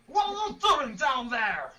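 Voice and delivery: slow, shouted goblin yell from above. Acted. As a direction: bark it down like a goblin. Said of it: "Goblin voice".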